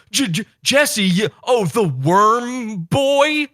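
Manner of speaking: high, slow, stuttering mock voice; stammering nervous man. Mocking the nervous caller who blurts an insult, high stammer. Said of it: "And he goes".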